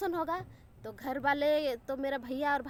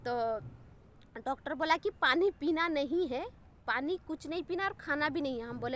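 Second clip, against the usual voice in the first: ordinary talk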